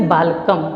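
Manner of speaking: बालकम closed on m, no vowel after it; बालकम with lips closing on the last m; halant pronunciation. Shown as a demonstration. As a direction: stop बालकम on the final consonant. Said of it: "स्वर की मात्रा को उच्चारित हम नहीं करेंगे".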